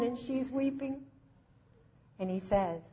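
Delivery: ordinary talk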